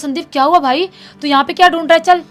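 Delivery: acted bossy, rapid-fire scolding; scolding brother. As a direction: order a younger brother inside with a threat. Brother scolds and threatens younger brother.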